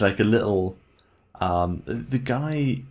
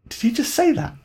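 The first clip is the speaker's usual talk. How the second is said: much higher, stunned question; shocked disbelief; 4 dB louder than usual. Retold or imitated. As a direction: gasp it out in disbelief. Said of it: "you're like".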